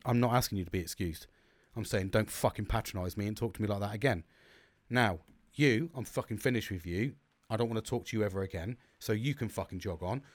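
low, soft, controlled anger; retold telling-off, dropped low and quiet; quiet threat. Customer finished with patronising mechanic, quietly menacing.

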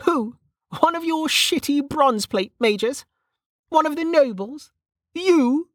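high-pitched, mocking questions; scornful amusement. A narrator's reading. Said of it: "she snorted in amusement".

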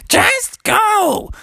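very high, drawn-out shout; furious mommy frog. Acted. Mom sends them back, furious shriek. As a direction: shriek a hammed-up motherly threat. Mother orders frogs out.

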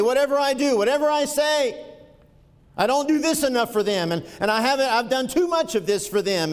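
high, loud, impassioned preaching; fervent pulpit cry; preaching at full force. Acted. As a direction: drive it home to the congregation.

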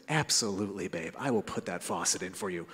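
very low, soft mock-agreeable voice; hushed, low-pitched husbandly assurance; dutiful husband. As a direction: drop deep and quiet for an empty promise. Retold dutiful husband's insincere promise.

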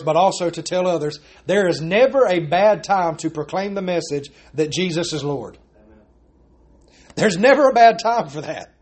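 ordinary talk

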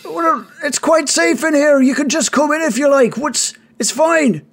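high-pitched character voice calling out; calling back. Acted. Savid answers the caller outside, high-pitched calling back. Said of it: "Savid says".

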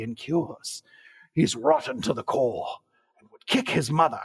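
put-on old wizard voice; theatrical wizard pronouncement; Gandalf. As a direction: intone it like a sage wizard. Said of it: "a Gandalf ass voice for this".

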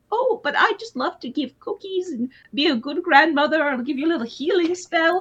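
high sweet grandma voice; old lady voice. Retold or imitated. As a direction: play a sweet little old granny. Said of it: "your old lady voice".

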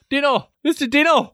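high, squeaky-pitched mock call; mimicking the put-on voice. Host imitates co-host's Sam voice, high mock call. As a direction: parrot it back far above your own pitch. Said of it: "and not that".

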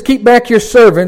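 loud, high pulpit proclamation; preacher reading the psalm aloud; proclaimed scripture. Acted. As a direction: ring the verse out.

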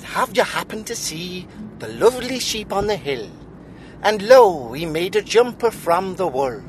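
Welsh lilt sliding into Irish; high sing-song Welsh storyteller; attempted Welsh accent. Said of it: "that that's Irish".

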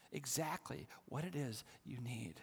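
ordinary talk, nothing marked

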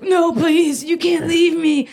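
high, loud mock begging; pleading boyfriend. Boyfriend being broken up with, mock desperate plea. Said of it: "breaking up with, like, a boyfriend".

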